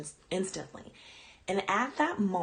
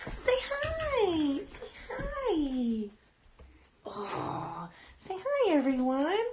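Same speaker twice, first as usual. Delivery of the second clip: pet talk; 9 semitones higher, much slower than usual. Real, not acted. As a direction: coo at the puppy to coax her over. Showing dog to viewers.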